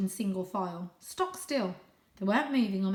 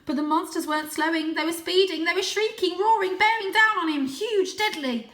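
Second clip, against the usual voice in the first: louder, higher, urgent storytelling; rising tense narration; 10 semitones higher, 8 dB louder than usual. A narrator's reading. Reader drives the climax as cars charge, higher and louder. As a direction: build the danger to a peak.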